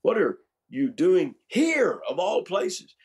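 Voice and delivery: HERE high, loud and drawn out; stress on HERE. Shown as a demonstration. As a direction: punch and stretch the word here. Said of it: "Where did he inflect that sentence".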